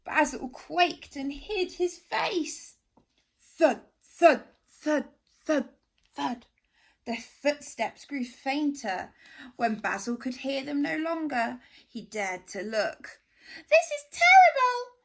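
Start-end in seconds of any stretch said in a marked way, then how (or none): 3.4-6.5 loud thuds dying away; fading heavy thuds. A narrator's reading. Monster footsteps passing. 13.7-15.0 high, loud wail. Acted. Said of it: "he wailed".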